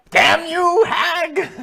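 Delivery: high, loud screechy hag yell. Acted. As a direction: shout it like a cackling witch. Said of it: "Why is [name] talking like the hag now?".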